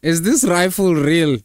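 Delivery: suddenly alarmed questioning; surprised disbelief. Real, not acted. Spots a rifle in the video.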